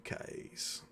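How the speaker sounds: ordinary talk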